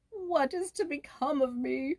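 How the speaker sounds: a sick man crying out in despair. Acted. Sick peasant laments to his weeping family, despairing. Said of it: "cried the poor peasant".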